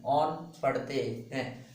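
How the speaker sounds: on पढ़ते हैं drawn out very slowly, word by word; slow dictation. Shown as a demonstration. Teacher to students, slowly repeating the suffix name. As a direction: spell it out slowly for the class to write. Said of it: "CO को IUPAC में on पढ़ते हैं".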